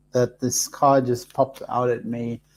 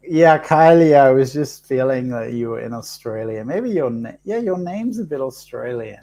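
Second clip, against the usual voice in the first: usual speaking voice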